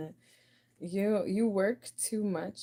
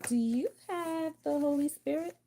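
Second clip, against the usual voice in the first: high mock go fish asker; 9 semitones higher than usual. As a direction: stretch the question up high as if begging for a card. Acting out a go fish game.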